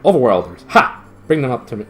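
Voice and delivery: loud commanding shout. Acted. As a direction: bark the order down the stairs. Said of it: "it shouted".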